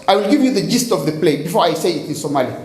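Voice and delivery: normal talking voice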